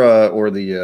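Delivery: ordinary talk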